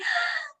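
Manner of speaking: high sharp gasp of delight; excited gasp. Acted. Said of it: "Boo Boo was all excited".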